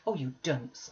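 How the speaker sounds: ordinary delivery, nothing marked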